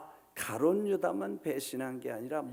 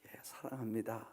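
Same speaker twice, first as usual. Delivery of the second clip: quiet answer; 6 semitones lower, 11 dB quieter than usual. Acted. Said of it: "베드로에게 묻습니다".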